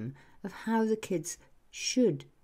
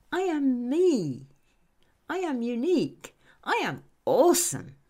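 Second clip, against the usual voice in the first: proud affirmation; 5 semitones higher, 6 dB louder than usual. Shown as a demonstration. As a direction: proclaim it proudly to yourself. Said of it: "say out loud with me".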